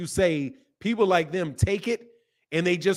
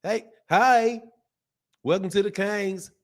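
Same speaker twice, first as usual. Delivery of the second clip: higher-pitched Southern welcome; put-on Southern twang; Southern accent; 5 semitones higher than usual. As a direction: greet like a twangy Southern host. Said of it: "That's Southern".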